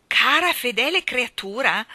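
high, loud, incredulous cry; shouted disbelief; indignant shout. A narrator's reading. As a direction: throw it back at her, outraged. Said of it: "gridò il signor Banks".

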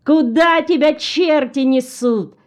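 high-pitched narrated shout, moderately loud; shouted call. A narrator's reading. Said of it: "закричал он". (выкрик высоким голосом)

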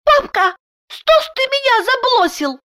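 put-on very high lisping child voice, unwhispered; little girl. A narrator's reading. Daughter hugs papa and asks why he left, in child voice. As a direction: lisp it like a small daughter. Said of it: "обняла и шепнула".

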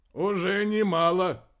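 loud, high, slow caustic comment. A narrator's reading. Venson commenting on a poor memory, caustic. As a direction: needle them, drawing it out loudly. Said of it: "язвительно прокомментировал Венсон".

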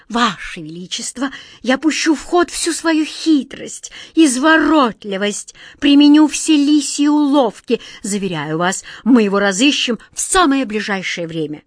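a hint of a sly fox of a courtier; fawning courtier. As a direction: wriggle and flatter the king. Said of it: "Извиваясь всем телом".

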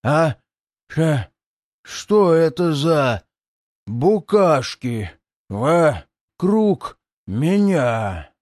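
slow, puzzled gurgle; gurgling demon voice. Acted. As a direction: bubble the words out like a bloated monster. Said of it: "недоумённо булькнул предатель дьявола".

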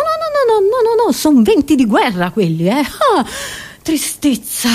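ordinary talk

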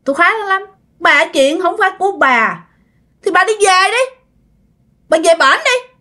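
high, taunting spirit voice, well above her own; mock the old woman from a great height; possessed girl's voice. Possessed girl sending bà O home, high and taunting.

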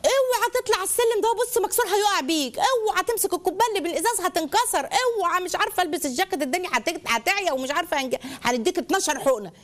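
high-pitched parental cautions tumbling out; acted overprotective parent, pitch settling gradually; anxious warnings. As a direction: warn a child off every danger. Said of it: "اسلوب التحذيرات".

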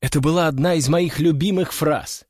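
plain reading voice

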